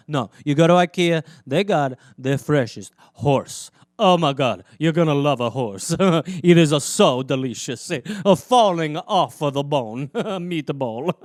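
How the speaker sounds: loud, hammed-up sales pitch with laughs; mock pitchman. Comedian pitching ikea horse meatballs, loud mock salesman. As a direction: sell it like a cheesy ad man.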